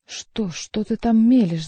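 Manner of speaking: ordinary delivery, nothing marked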